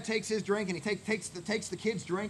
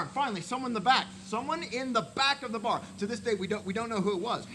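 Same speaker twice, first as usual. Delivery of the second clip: usual speaking voice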